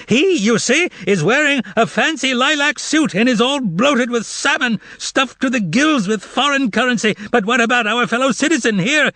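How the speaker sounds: high, sarcastic wailing lament. A narrator's reading. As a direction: wail like a best man at a wedding. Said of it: "wailed Korovyov".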